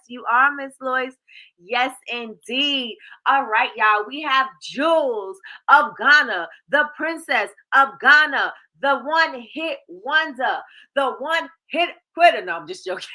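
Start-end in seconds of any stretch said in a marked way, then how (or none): 3.2-12.4 slow, loud emcee build-up; hype announcer. Acted. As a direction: introduce her like a star. Hype announcer intro for Jules.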